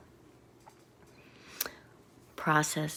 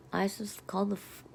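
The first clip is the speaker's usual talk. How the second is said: ordinary delivery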